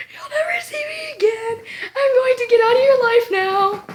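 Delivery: high-pitched, hammed-up crying voice; tearful farewell. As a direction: wail a melodramatic goodbye, mock sobbing. Acts out tearful exit after photo.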